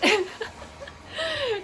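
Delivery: high bursting laugh; real burst of laughter. Real, not acted. Vlogger laughing at the pool noise behind her, high real laugh. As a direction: crack up at the noisy pool.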